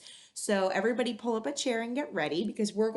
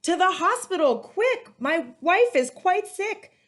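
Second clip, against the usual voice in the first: high, loud panicked shout; frantic cry; 11 semitones higher, 9 dB louder, slower than usual. Acted. Man hailing the taxi for his sick wife, panicked shout. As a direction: yell it like a husband rushing for help. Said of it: "cried a man".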